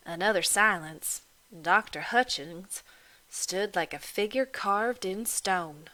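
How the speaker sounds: usual speaking voice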